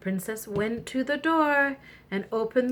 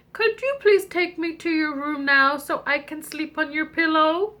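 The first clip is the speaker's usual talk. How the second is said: high frog voice; 6 semitones higher than usual. Acted. Frog asks to sleep.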